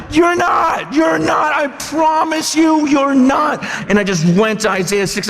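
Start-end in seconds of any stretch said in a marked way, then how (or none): 0.1-3.6 urgent shouting. Retold or imitated. Preacher shouting at a man who says he's ready for God, urgent plea. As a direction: shout it like you're begging him to believe. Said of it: "And I'm like".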